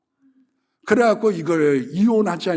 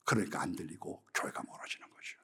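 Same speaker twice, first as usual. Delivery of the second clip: whisper; 12 semitones lower, 14 dB quieter than usual. Real, not acted. Aside after quoting gossip.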